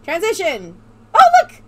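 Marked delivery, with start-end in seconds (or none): 1.1-1.6 excited. Real, not acted. Spots tiny Englishman on screen.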